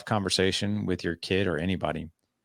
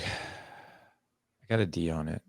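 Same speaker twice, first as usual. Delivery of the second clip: reluctant admission; 4 semitones lower, 8 dB quieter, much slower than usual. Retold or imitated. Son admits bad grade.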